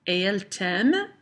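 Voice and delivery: "t'aime" swept upward as a question; rising intonation. Shown as a demonstration. Teacher shows the class an intonation question, rising intonation. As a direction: let the voice climb on "t'aime" to ask it. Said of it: "my intonation rises with the question".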